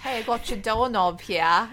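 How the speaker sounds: laughing Australian impression; Australian accent. Shown as a demonstration. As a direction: put on a broad jokey Australian accent. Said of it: "Do you wanna do one?".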